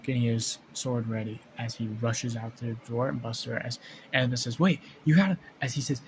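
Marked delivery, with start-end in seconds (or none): 4.6-5.4 louder, higher burst of urgency; urgent interruption. Acted. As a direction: cut in urgently to stop someone. Annabeth calls to halt him.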